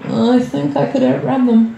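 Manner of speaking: slow, musing self-assurance; a hint of a dim inner voice; lightly voiced overconfident brain. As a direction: ponder it with foolish confidence. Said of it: "And your brain goes".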